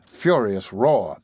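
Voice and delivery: plain reading voice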